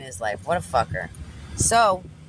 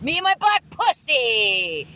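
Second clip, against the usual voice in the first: exaggerated impression; 7 semitones higher, 3 dB louder, slower than usual. Climax of her impression of him.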